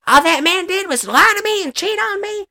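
high, sing-song mock grievance; voicing Dolly. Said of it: "voicing Dolly's complaint".

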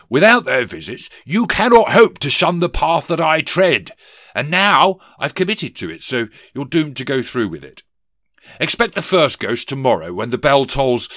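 loud, high pronouncement, petering into vagueness; blustering ghost. Acted. Ghost instructs Scrooge on the visits, loud and pompous. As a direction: proclaim doom, then fumble the detail.

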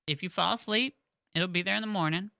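ordinary talk, nothing marked

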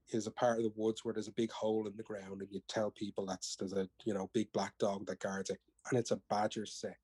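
usual speaking voice

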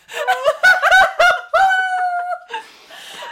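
long, very high, loud laugh; delighted shriek of laughter. Real, not acted. Reacting to a saucy passage just read out, shrieking laughter. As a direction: burst out in a loud high-pitched cackle.

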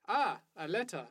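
lightly put-on Eastern European accent, laughing through; mock villain accent. Gamer mimicking a truck driver, in a mock Eastern European accent. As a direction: stiff game-guard voice with a laugh. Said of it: "generic video game Eastern European villain accent".

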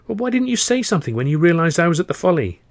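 high-pitched, indignant question; acted reproach. Asking an old friend about the Folly, reproachful.

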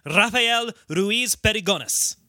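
Spanish name with rolled R; Spanish accent. Shown as a demonstration. Host showing how he wants to say the name, Spanish accent. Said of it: "You wanna say".

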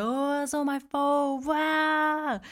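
mock anime scream. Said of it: "started just screaming".

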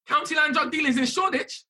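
incredulous echo. Retold or imitated. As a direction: throw the officer's words back in disbelief. Disbelief at officer's claim.

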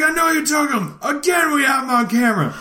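high, fast put-on old man shout; old man yelling. Clerk shouting at the thief in a mock old man voice. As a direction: yell like a cranky old man. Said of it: "yelling in an old man voice".

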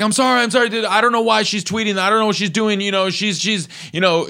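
high-pitched flustered apology voice; imitating union president. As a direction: squeak it up like a panicked guy saying sorry.

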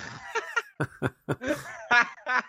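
long giggle. Real, not acted. Co-host laughs at alpha.

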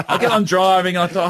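ordinary talk

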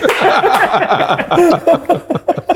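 high hearty laugh; long laugh. Real, not acted. Laughing at own story.

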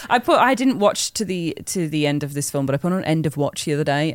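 normal talking voice

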